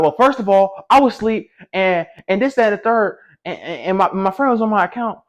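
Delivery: high-pitched mocking rant; imitated girl going off. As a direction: mimic her indignant excuses in a high voice. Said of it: "she started going off".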